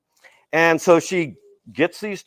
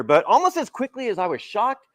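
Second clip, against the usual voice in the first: ordinary talk, nothing marked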